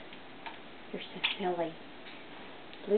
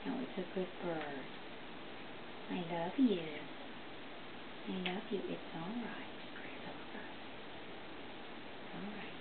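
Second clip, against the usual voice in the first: hushed, calming whisper; calm the frightened bird softly; soothing whisper; 4 semitones lower, 14 dB quieter than usual. Real, not acted. Soothing a scared parrot.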